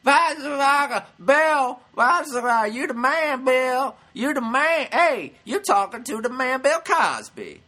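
loud, high mock-drunk hollering; imitated drunken yelling. Drunk heckler telling Bill he's the man, yelled imitation. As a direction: holler like a sloshed fan. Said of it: "starts yelling and screaming".

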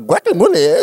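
Guacamole said with an exaggerated Spanish accent; overdone native Spanish Guacamole, high; mock native pronunciation. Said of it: "She would go out of her way to say it".

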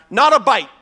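high, loud shouted reply; yelling back. Retold or imitated. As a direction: holler it back to shore. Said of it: "One of them yells back".

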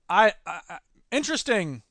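ordinary talk, nothing marked

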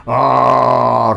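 loud giant roar; giant's roar. A narrator's reading. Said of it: "rugió el gigante".

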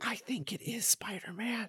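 put-on little girl's awed whisper. A girl whispering to her mom in a barbershop, hushed. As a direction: breathe it like a secret. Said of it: "whispering to her mom".

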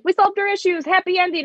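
high, sing-song sarcastic voice; mock the tidy ending. Mocking the show's ending to her co-host, sarcastic high voice. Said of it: "it was just like".

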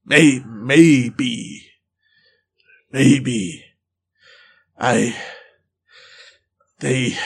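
slow single words, long pauses; halting search for words. Acted. Wild man struggling for words, halting. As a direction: grope for what to say. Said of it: "he's struggling for words".

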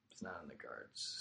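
very soft, low, almost whispered; resigned murmur. Real, not acted. Athlete admitting a comeback is unlikely, resigned murmur. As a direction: let it sink to a near whisper. Resigned repeat of same line.

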